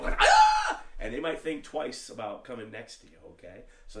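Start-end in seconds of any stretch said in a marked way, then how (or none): 0.2-0.8 very high, very loud held kiai shriek; crazed kiai scream. Shown as a demonstration. Teacher shows how to make opponents think you're crazy, screamed kiai. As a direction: scream a kiai like someone slightly unhinged. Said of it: "a few fries short of a Happy Meal".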